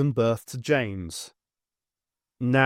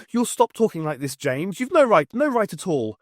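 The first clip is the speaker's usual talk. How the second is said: furious mother; 7 semitones higher, 4 dB louder than usual. A narrator's reading. Mother slaps and scolds.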